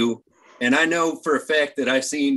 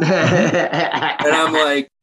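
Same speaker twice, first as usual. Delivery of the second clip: laughing; 3 dB louder, much slower than usual. Real, not acted. Laughing while retelling reply.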